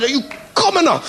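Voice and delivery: high, sneering mock aristocrat; snooty disdain. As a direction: look down your nose at a commoner. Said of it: "this look of utter".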